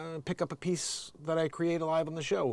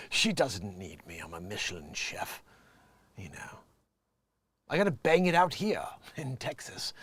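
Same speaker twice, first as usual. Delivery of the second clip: lightly mocking, lower and softer dopey voice; stupid voice; 7 semitones lower, 4 dB quieter than usual. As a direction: mimic him in a dumb low voice. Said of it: "he has that stupid type of voice".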